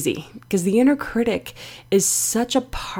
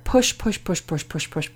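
rapid hushed repeated push; relentless pushing; 3 semitones lower, 5 dB quieter than usual. Acted. Inner critic keeps driving her on, hushed and relentless. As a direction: rattle it off under the voice, dropping away.

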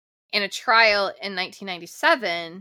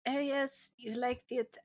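put-on playful light Italian accent, softer; 6 semitones higher, 8 dB quieter than usual. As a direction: tease her with a mock Italian accent. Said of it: "I don't know why I'm using that accent".

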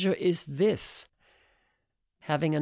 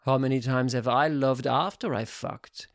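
usual speaking voice

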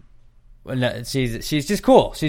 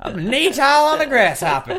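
mock Sam voice; 6 dB louder than usual. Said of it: "And I was like".

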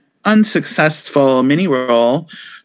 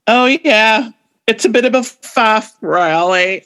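British accent attempt; 6 semitones higher than usual. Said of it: "Speaking of not being able to do an accent, I'm gonna try this".

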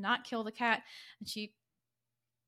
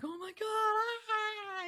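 high-pitched mock panic rising to a yelp; freaking out; 12 semitones higher than usual. Drunk man sees the noose on the cat, freaking out. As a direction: play him spooked, ending in a shriek. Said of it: "He's like".

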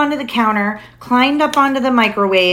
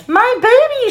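high, louder cry of alarm; frightened cry; 5 dB louder than usual. A narrator's reading. Mother sees her baby in danger, high alarmed cry. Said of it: "she cried".

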